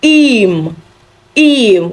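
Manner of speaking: teacher models Im slowly for students; German im. Shown as a demonstration. German teacher modelling Im. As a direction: say Im with a short clear i. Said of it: "you use the preposition im".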